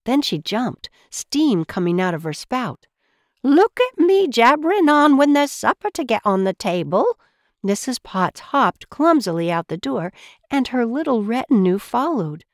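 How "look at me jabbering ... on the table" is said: high, loud, fussing teapot voice; flustered housekeeper. Acted. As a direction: fuss at yourself in a raised motherly voice. Flustered housekeeper chides herself.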